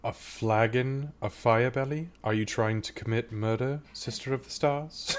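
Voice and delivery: priestess questioning in lilting disbelief. Acted. Priestess teasing the Sister of the Stars, incredulous. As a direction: question it with a rising, theatrical lilt.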